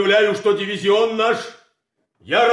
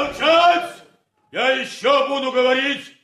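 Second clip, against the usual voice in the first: high furious command; angry shout; 5 semitones higher than usual. Acted. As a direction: roar them into silence. Colonel silences the room. (гневный окрик)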